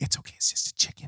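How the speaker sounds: very soft, near-whispered comfort; hushed reassurance. Retold or imitated. Parent reassuring a child woken by mom's sobbing, hushed. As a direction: murmur it to soothe a child.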